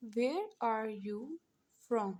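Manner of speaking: "Where are you from?" said slowly, gently, in pieces; teacher repeating the English question slowly for the class; slow dictation. Shown as a demonstration. Teacher repeating the English question slowly, in pieces. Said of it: "English में कहते हैं".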